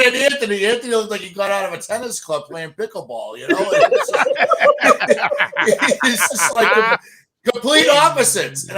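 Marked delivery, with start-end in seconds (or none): 3.7-8.7 talking through big laughter; laughing. Real, not acted. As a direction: laugh while saying it, high and loud.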